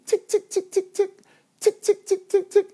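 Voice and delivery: very high clicky tick tick of a pickaxe; high ticking pickaxe noise. Retold or imitated. Dreamer imitating his pickaxe on the diamond, high ticking. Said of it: "it sounds like this".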